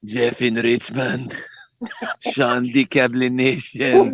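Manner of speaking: slow, drawn-out caller impersonation; slow mock caller voice; Vic impression. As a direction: stretch each word out in a put-on voice. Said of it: "request to hear the Vic voice again".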